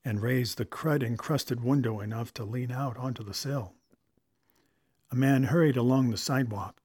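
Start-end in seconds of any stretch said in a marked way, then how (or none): none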